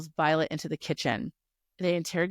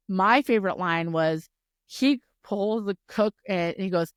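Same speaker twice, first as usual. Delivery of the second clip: normal talking voice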